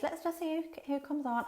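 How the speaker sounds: high, sing-song live-stream host stalling; mimicking a stalling host. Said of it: "thing where she goes".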